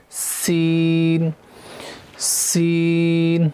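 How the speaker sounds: سین slow with a hissing whistle; whistled seen. Shown as a demonstration. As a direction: stretch سین and let it hiss. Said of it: "اس میں بھی سی ٹی آئے گی". (سین سیٹی کے ساتھ، آہستہ)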